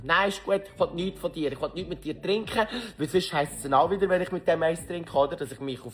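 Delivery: loud high brush-off; firm refusal. Retold or imitated. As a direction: shut him down firmly. Refusing drink firmly.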